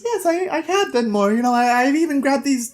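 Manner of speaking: put-on high, eager child's voice; happy little boy. Locke shows the Thiefmaker his loot, happy boy voice. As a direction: pipe up proudly as a small boy. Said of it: "Locke is very happy about this".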